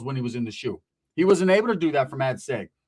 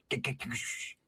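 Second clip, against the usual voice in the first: low whispered paranoid shushing; mock hushing whisper; 6 semitones lower, 15 dB quieter than usual. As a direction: shush them like someone might be listening. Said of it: "Why are you being all quiet".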